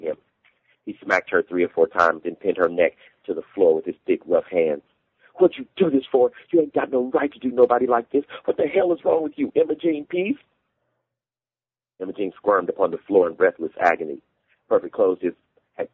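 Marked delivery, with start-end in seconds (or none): 5.3-10.4 high-pitched, furious outcry; enraged. A narrator's reading. Husband pinning his wife to the floor, enraged. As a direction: demand an answer, voice pitched way up.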